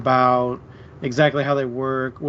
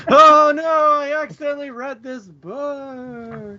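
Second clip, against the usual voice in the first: mock TikTok girl; 12 semitones higher, 4 dB louder than usual. Mocking a TikTok poster who read a romance by accident, high hammed-up whine. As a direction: ham up a ditzy TikTok confession. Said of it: "one of those terrible TikToks".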